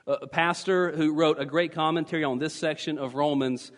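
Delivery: ordinary delivery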